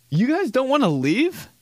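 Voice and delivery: high put-on voice asking in disbelief; mock incredulous. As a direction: squeak it like you can't believe they stay. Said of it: "I'd be like".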